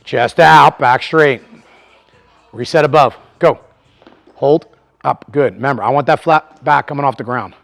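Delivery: loud, high gym-floor cues; coaching calls. Real, not acted. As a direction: call it across the gym to your lifter. Coach calling cues to lifter.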